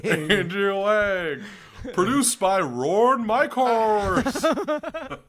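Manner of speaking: loud, high, very drawn-out announcer; spoof show announcer. Pretend. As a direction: bellow the credits in hammed-up announcer style.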